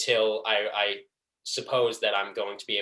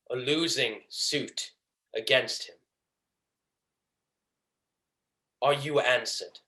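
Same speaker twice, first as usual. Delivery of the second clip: soft, measured villain with quiet satisfaction; Shylock; 5 dB quieter than usual. Acted. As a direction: finish softly, savouring each phrase. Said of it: "hatred and pleasure that I tried to deliver this monologue".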